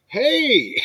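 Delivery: high, drawn-out "hey" to get attention; high-pitched fan hail; excited call-out. Retold or imitated. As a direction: call out like an eager young fan. Retold kid calling out.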